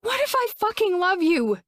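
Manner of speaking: high, loud, raw yell; desperate yell. Acted. Woman yelling her love at the man walking away, desperate. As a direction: throw it at his back, desperate. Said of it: "she yelled".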